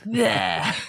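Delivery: drawn-out grumpy "bleh" noise; imitated disgruntled blurt; mock pissed-off "bleh". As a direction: blurt a sulky mock growl. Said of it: "now he's all pissed off again".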